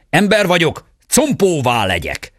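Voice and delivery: high magic cry of the dragon king; calling out. A narrator's reading. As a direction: shout the spell in pursuit. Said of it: "A sárkány király is fölkiáltott".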